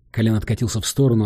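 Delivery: plain reading voice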